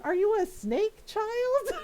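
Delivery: put-on mother aghast at her baby gulping food; incredulous. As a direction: ask it like you can't believe your eyes. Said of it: "She's like".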